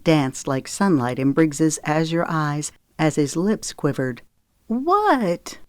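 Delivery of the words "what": demanding. Acted. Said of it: "Zoe demanded".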